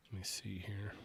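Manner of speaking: muttering to self; whispering. Real, not acted. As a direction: whisper while skimming. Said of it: "Bear with me as I skim through".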